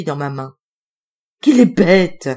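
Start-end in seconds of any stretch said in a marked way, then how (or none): 1.4-2.3 loud, exasperated outburst; exclamation. A narrator's reading. Woman spots the biscuit trick and exclaims at her silly dog. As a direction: exclaim it sharply and loudly. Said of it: "s'exclama-t-elle".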